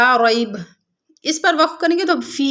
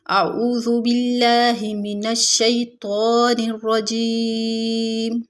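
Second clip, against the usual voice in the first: slow, drawn-out Quranic chant; tajweed recitation; much slower than usual. Shown as a demonstration. Teacher reciting Quran for students.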